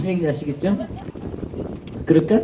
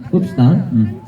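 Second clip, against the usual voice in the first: ordinary delivery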